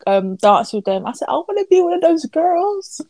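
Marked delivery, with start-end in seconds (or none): 1.3-3.0 high-pitched eager wish; playfully acted yearning; retold fan longing. Retelling own fan longing.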